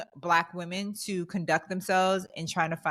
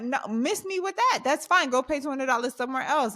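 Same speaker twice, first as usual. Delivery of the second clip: higher, sharper "no, miss me with that" brush-off; sassy brush-off; 6 semitones higher than usual. Retold or imitated. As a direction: swat the offer away with attitude. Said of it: "I'm like".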